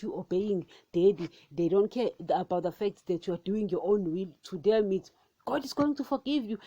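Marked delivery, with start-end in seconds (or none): 5.5-6.6 higher-pitched mock reassurance; imitated prosperity preacher. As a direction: lightly voice a pastor promising easy forgiveness. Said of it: "they will tell you that God is going to forgive".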